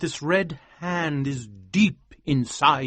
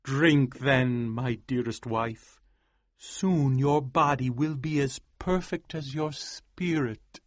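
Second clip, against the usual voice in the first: softer, gentle offer; tender husband; 4 dB quieter, slower than usual. Acted. Husband offering wife potion, soft tender voice. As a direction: give her the potion tenderly.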